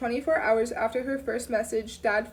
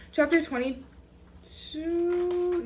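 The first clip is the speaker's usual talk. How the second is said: chapter title, put on; 6 semitones higher, much slower than usual. Shown as a demonstration. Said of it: "yes, chapter twenty-two".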